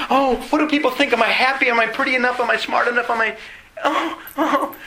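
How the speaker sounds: high, silly mock voice of self-doubt, breaking into a laugh; mocking the insecure crowd, high and giggly; mock fretful voice.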